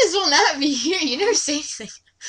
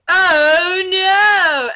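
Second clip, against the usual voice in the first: high, loud mock wail of defeat; hammed-up villain dismay; 6 semitones higher, 7 dB louder than usual. Reading the Dark Lord's defeat aloud, high and wailing. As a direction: wail like a beaten cartoon villain.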